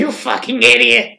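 Marge voice. Acted. Marge scolding Homer.

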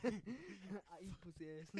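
slow line broken by laughter; laughing. Pretend. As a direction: giggle through a silly boast. Laughing in-character joke.

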